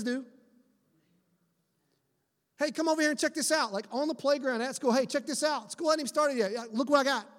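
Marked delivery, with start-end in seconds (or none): 2.6-3.7 higher, fast, eager call; a kid waving his friend over. Retold or imitated. Friend on playground showing phone. 4.9-7.3 higher, fast, eager call; a kid waving his friend over. Retold or imitated. Friend on playground showing phone.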